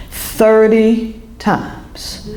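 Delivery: each word drawn way out; very slow, weighty retelling; slow emphatic quote. Retold or imitated. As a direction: stretch it out for dramatic weight. Stranger's count retold with great emphasis.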